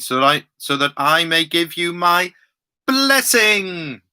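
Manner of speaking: blessing lifted high, then falling away; emphasis on blessing. Shown as a demonstration. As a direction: ring out the word blessing, high, then let it fall. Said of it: "I'm gonna keep emphasizing that word".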